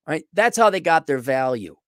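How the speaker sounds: normal talking voice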